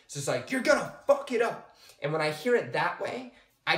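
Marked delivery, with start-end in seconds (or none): 0.5-1.7 higher, louder angry critic; furious inner critic shouting. Shown as a demonstration. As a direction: bark it at yourself. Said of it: "hear it loud and angry".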